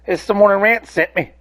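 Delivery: mock customer greeting. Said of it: "and be like".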